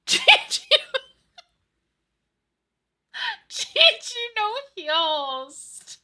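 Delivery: high squealing giggle through GG; cracking up while mocking the tank; laughing mock callout. Healer mocking her team over voice chat, laughing. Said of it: "we say that all the time".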